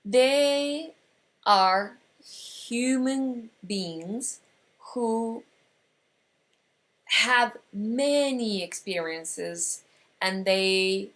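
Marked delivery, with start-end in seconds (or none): none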